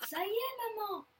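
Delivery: high, excited shout to mum; exclaiming. A narrator's reading. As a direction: announce the catch triumphantly. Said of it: "s'écrie Loustic".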